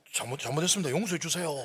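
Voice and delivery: loud, hurried pleading; apologizing teens. Retold or imitated. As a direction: imitate cowed kids begging pardon. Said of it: "갑자기 머리 숙이면서".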